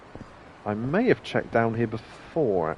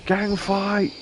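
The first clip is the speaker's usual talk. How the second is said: excited; 9 semitones higher than usual. Real, not acted. Calls out excited game moment.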